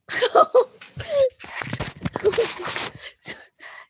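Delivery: long, high, breathy laugh; helpless laughing fit; real laughter. Real, not acted. Friend who mistook who was crying, laughing at herself.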